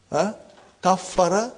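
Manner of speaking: ordinary delivery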